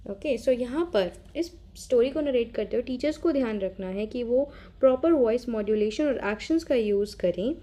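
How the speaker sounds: ordinary talk, nothing marked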